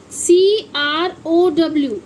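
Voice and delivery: slow letter-by-letter spelling; spelled out slowly. Shown as a demonstration. Teacher slowly spelling a word for her pupils. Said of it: "इसे हम बोलते हैं crow".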